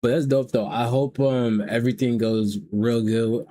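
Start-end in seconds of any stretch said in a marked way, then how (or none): none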